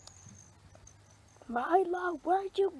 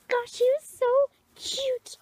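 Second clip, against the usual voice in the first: dreamy swoon; 9 semitones higher than usual. Acted. Girl swooning over a boy.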